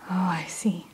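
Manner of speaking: ordinary delivery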